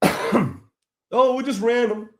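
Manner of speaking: high, sneering mock voice of the officer; mocking official. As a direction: parody their lame excuse with a snide lilt.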